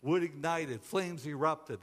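usual speaking voice

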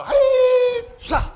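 huge high yelled hey-cha with a laugh; mock war cry, very high and loud; bee battle cry. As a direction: shout it like a bee attacking.

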